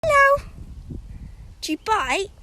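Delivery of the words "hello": high loud hello; high pet greeting. Real, not acted. Greeting a horse.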